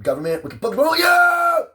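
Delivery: high, loud, slow furious yell; angry shout. Real, not acted. Ranting at the government, a furious high shout.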